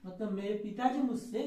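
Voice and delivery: ordinary talk, nothing marked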